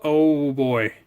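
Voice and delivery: ordinary talk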